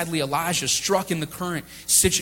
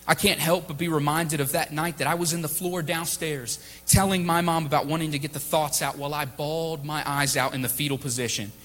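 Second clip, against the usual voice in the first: ordinary talk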